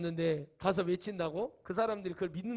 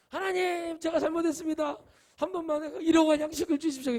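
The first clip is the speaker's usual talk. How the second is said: desperate prayer; 7 semitones higher, 6 dB louder than usual. Retold or imitated. Character prays in panic on mine.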